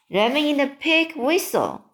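high-pitched, lightly startled cry; mild exclamation. A narrator's reading. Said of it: "cried the B F G".

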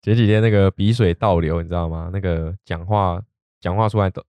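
normal talking voice

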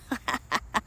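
quiet, breathy chuckle; self-amused laugh. Real, not acted. Teacher joking about mahjong exhaustion, amused chuckle. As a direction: chuckle softly at your own story. Laughs at own tiredness story.

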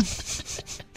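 soft breathy laugh. Real, not acted. Guest laughs at snobbery.

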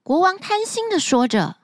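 plain reading voice

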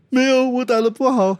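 high, loud mock whine; imitating a boxer refusing comfort; upset boy. Coach acting out the boxer rejecting his praise, high mock wail. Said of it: "他难过啊".

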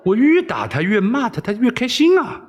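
high, bewildered exclamation; incredulous. Acted. Wei Xiaobao amazed the princess enjoys beatings, incredulous. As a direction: marvel up high at her craziness. He marvels at her madness.

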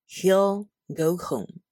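very slow "He'll" with a clear final L; slow model reading. Shown as a demonstration. Teacher drilling the contraction "He'll". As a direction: drill it slowly. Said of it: "就会变成".

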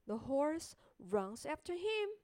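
ordinary delivery, nothing marked

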